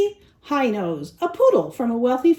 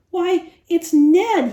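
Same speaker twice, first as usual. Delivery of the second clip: slow, higher, louder shocked recognition; gasped; 6 semitones higher, 6 dB louder, slower than usual. Acted. Nicholas recognizing the injured neighbor, surprised gasp. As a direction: gasp as you recognize him. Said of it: "he gasped".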